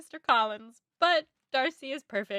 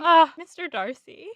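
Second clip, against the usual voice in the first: put-on swooning British accent; 6 semitones higher, 7 dB louder than usual. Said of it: "half bad British accent".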